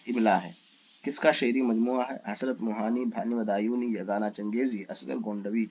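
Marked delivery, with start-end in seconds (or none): none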